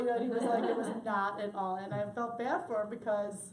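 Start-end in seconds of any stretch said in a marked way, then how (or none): 0.6-1.7 flat, even monotone; lightly voiced flat teen. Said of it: "in his monotone voice".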